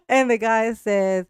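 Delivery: ordinary delivery, nothing marked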